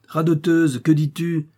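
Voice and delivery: irritated father's retort; cross rebuke. A narrator's reading. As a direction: cut the daughter off crossly. Father rebukes his hesitant daughter.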